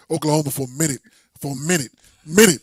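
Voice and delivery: building hype. Real, not acted. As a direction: pump it up until it almost shouts. Hyping his state, building.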